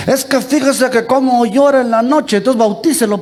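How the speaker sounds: high-pitched, lightly mocking imitation of a parent; lightly voiced pleading parent. Voicing a parent's excuse to priest.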